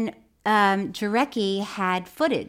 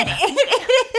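high, slow, laughing; 14 semitones higher, 4 dB louder than usual. Real, not acted. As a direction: agree through giggles.

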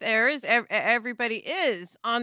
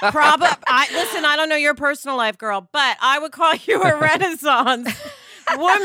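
words broken by real laughter, louder; laughing while talking; 3 semitones higher, 5 dB louder than usual. Real, not acted. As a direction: giggle through the compliment. Laughing through her compliment.

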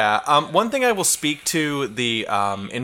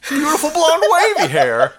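high, loud cartoon gushing; hammed-up, high cartoon admiration; weird cartoon voice; 13 semitones higher, 5 dB louder than usual. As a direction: gush as a cartoon announcer, near laughter. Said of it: "cartoon voice would be like".

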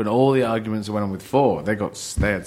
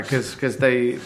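ordinary talk, nothing marked

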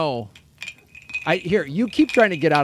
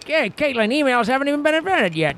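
much higher 1920s gangster; old-timey mob voice; 10 semitones higher than usual. Acted. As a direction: mobster with a "see?". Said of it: "The old-timey mob voice?".

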